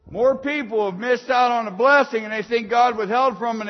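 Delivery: loud, high, fast preaching; fiery preaching. Acted. Preacher exhorting the congregation, loud fast preaching. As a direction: drive it home to the congregation.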